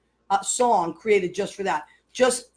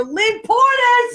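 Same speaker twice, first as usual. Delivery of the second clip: high, slow, loud name call; drawn-out shout; 12 semitones higher, 7 dB louder, much slower than usual. Real, not acted. Host calling out friend's name.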